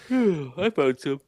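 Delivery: sigh. Real, not acted. Reluctant reviewer sighs.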